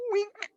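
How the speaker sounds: tiny high squeak; little high-pitched "wink" while dragging; playful sound effect. Shown as a demonstration. Imitates noise of dragging snip.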